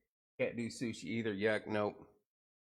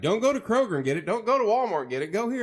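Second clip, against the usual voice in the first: mock advice; 10 semitones higher, 10 dB louder, faster than usual. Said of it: "everybody's like".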